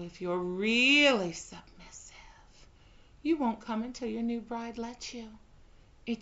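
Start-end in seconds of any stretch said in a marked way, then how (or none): 0.0-2.1 breathy teasing whisper. Acted. Bride whispering a tease to her pet. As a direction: drop to a sly whisper.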